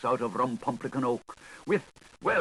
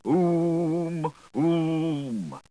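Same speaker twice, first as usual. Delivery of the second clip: booming oom; 5 semitones higher, 5 dB louder than usual. Acted. Booming sound in the tale.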